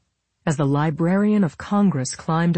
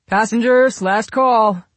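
loud, raised boarding call; shouted; 7 semitones higher, 7 dB louder, slower than usual. A narrator's reading. Young airman calling passengers to board, shouted. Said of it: "the young airman shouted from inside".

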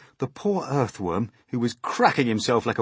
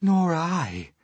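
lightly voiced ladybird, higher; high, emphatic ladybird reply; 10 semitones higher, 5 dB louder than usual. A narrator's reading. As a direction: agree as the shaken ladybird. Ladybird agrees in character voice.

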